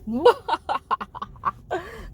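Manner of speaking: high villainous cackle; mustache twirling villain. Acted. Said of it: "Let me do my evil laugh".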